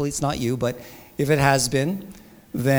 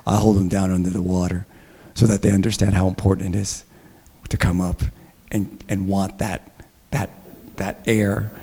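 imitating a pastor's big deep voice, much lower; Chuck Smith's deep voice; 8 semitones lower than usual. Pastor retelling an older pastor's baptism joke, deep booming voice. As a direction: drop low and boom it out like a veteran preacher. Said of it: "he's got this deep, very deep, big voice".

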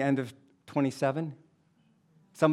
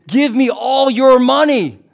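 high, loud, slow hammed-up stickup shout; mock robber yell; 9 semitones higher, 13 dB louder than usual. Robber in the joke demanding money, mock yelling. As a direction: bark it like a cartoon robber. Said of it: "he's yelling to the guy".

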